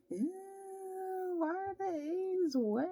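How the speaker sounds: high, slow, whiny ew of disgust; disgusted whine. Retold or imitated. Complaining about wet breakfast eggs, high whiny disgust. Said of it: "I was just like".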